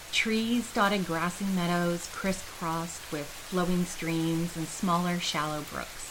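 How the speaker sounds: plain reading voice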